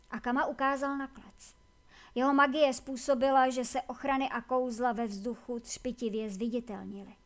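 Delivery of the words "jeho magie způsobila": ordinary delivery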